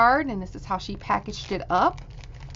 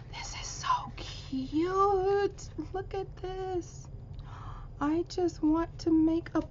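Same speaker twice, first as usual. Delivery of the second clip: high, slow, breathless whisper; awed whisper; 10 semitones higher, 8 dB quieter, slower than usual. Real, not acted. Marvelling at the gift card holder, whispering in awe.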